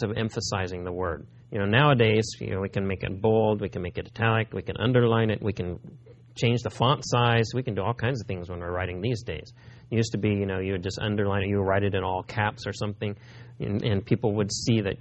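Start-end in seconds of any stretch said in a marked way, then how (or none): none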